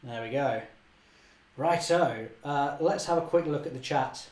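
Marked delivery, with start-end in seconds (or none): none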